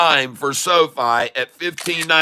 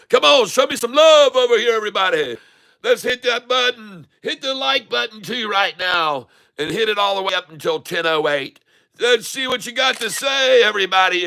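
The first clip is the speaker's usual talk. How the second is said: excited rallying; 7 semitones higher than usual. Real, not acted. Rallying viewers to celebrate.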